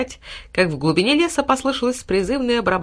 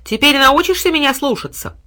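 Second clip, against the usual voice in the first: high and loud lordly taunt; haughty boy; 8 semitones higher, 6 dB louder than usual. A narrator's reading. Boy taunting the humbled dog, haughty. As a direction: taunt from above.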